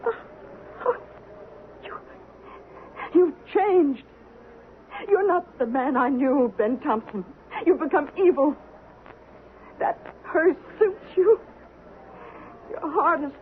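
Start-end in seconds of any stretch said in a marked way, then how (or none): none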